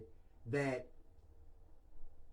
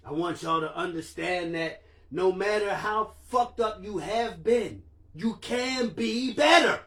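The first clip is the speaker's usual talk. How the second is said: emotional; 5 semitones higher, 7 dB louder than usual. Real, not acted. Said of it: "I got a little emotional in there".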